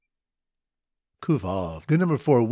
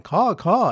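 higher, louder caw caw cry; mock bird call; 5 semitones higher, 4 dB louder than usual. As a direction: call like a crow, a little hammed-up. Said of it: "the bird callers weeping and going".

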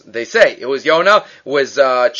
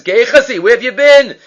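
raised, loud calling out; stern demand; 7 semitones higher, 6 dB louder than usual. Retold or imitated. Elisha confronting Gehazi, loud stern demand. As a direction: confront the servant sharply.

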